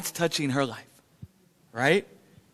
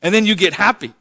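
ordinary talk, nothing marked

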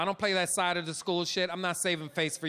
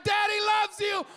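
embarrassing shout; 11 semitones higher, much slower than usual. Retold or imitated. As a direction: shout it across the schoolyard. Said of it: "I was like".

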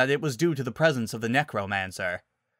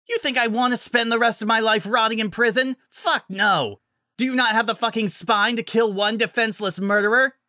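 furious shouting; 11 semitones higher, 5 dB louder than usual. Acted. Villain screaming at hero.

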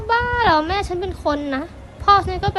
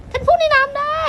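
high-pitched amazed realization; 8 semitones higher, 3 dB louder than usual. Acted. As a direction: gasp it out in wonder. Amazed discovery. (ประหลาดใจ)

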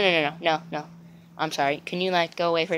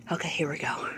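soft whispered aside; 5 semitones lower, 11 dB quieter than usual. Acted. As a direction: hush it like a secret. Whispering during piss bit.

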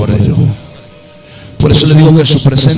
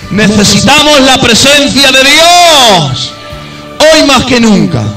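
emphatic declaration; 6 semitones higher, slower than usual. Acted. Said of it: "preacher repeats key line".

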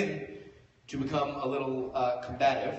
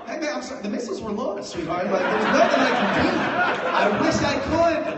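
higher, laughing, mock regretful; fake apologetic; 9 semitones higher, 8 dB louder than usual. Drunk friend mock apologizing to girlfriend, hammed-up. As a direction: shrug it off sweetly.

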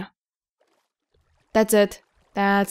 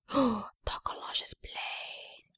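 awed whisper; 13 dB quieter, much slower than usual. Real, not acted. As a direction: gasp then hushed whisper. Spots a special item.

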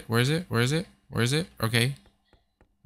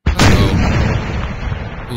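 hyped yell; 5 semitones lower, 8 dB louder than usual. Real, not acted. Hyped gamer exclamation.

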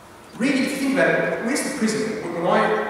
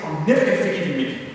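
annoyed grudge; 3 semitones lower, faster than usual. Retold or imitated. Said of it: "And I get really annoyed".

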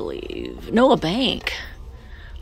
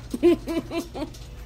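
high, quick giggle; real high-pitched laugh; 10 semitones higher than usual. Real, not acted. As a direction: let out a string of light giggles. Laughs while filming with friends.

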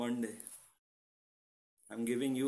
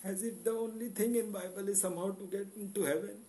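higher, impassioned plea; emotional outburst; 7 semitones higher than usual. Real, not acted. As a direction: pour out feeling about the story of love. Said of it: "Sorry for this emotional outburst".

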